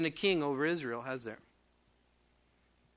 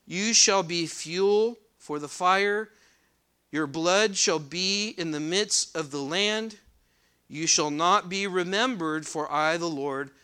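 raised, louder reading voice from the pulpit; scripture reading; 3 semitones higher, 6 dB louder, slower than usual. Acted. Preacher reading Ezekiel aloud to the congregation, proclaiming.

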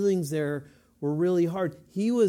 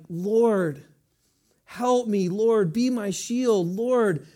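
loud, high, passionate plea; fervent prayer; 5 semitones higher, 7 dB louder, slower than usual. Acted. David pleading with the Lord for protection, fervent and loud. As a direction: cry out to God with urgent intensity. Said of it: "a fervency".

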